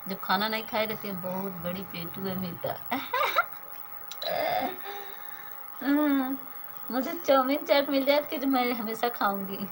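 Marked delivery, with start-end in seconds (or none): 2.8-6.9 high bursting laugh, caught off guard; real burst of laughter. Real, not acted. Teased by a viewer as greedy, she bursts out laughing.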